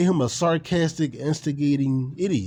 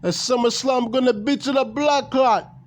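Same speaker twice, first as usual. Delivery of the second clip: hammed-up Jamaican patois, pitched higher; Jamaican accent; 8 semitones higher than usual. Wrestling fan imitating a Jamaican promo, mock Jamaican accent. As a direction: play a cocky Jamaican wrestler cutting a promo.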